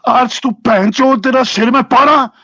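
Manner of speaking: loud, high Punjabi abuse; angry shouting. Real, not acted. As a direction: yell the insults at him. Swearing at Ards in Punjabi.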